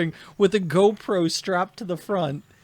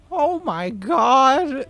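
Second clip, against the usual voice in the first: put-on high, slow, put-upon old woman voice; mock a whiny elderly complainer; slow high-pitched whine of an entitled old woman; whiny old lady; 8 semitones higher, 5 dB louder, much slower than usual. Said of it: "she goes like".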